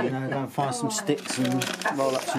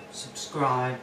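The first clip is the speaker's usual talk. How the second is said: usual speaking voice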